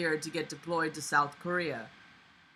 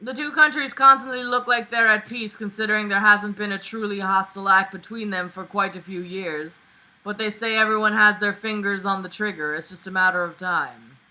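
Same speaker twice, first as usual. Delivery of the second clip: story reading; 3 semitones higher, 7 dB louder than usual. Acted. Reading fanfic aloud.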